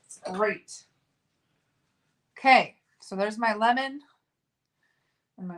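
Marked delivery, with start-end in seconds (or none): none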